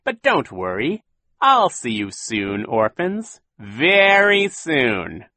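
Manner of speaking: taunting villain, swooping high and drawn out; Count Olaf. A narrator's reading. Villain bids the orphans farewell, gloating. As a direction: gloat slowly, stretching the final threat. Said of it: "said Count Olaf's voice".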